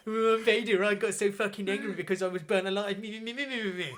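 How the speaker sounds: high, whiny send-up; hammed-up petulant whine, meant to ridicule; mocking Vader. Admirals secretly mocking Vader behind closed doors, whiny mockery. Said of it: "they're all mocking fucking Vader".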